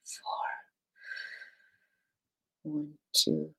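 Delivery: soft breathy whispered counting. Acted.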